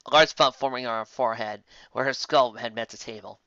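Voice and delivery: plain reading voice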